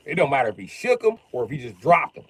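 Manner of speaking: normal talking voice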